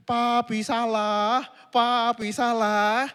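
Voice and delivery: put-on slow sing-song child taunt; slow childish chant of blame; child teasing. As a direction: drag it out like a kid teasing dad. Child teasing father in story.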